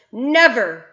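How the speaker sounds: loud, fervent denial; passionate cry. A narrator's reading. BFG insisting to Bloodbottler, passionate cry. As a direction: cry out with all conviction. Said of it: "cried the B F G passionately".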